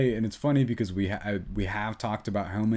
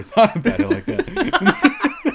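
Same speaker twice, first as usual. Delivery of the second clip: high laughing quote; cracking up mid-line; 8 dB louder, much slower than usual. Retold or imitated. Quoting the brother, cracking up.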